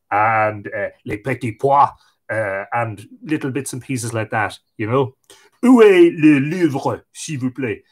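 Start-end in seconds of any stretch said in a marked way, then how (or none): 1.0-1.9 schoolbook French with an Irish accent; foreign accent in French. Shown as a demonstration. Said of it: "all I can say in French is". 5.6-7.8 slow, loud schoolbook French with an Irish accent; foreign accent in French. Shown as a demonstration. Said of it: "all I can say in French is".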